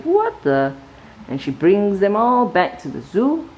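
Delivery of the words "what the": much higher, startled; startled wife. A narrator's reading. Wife finds the gorilla in bed, startled. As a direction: gasp it out as the shocked wife. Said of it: "she's like".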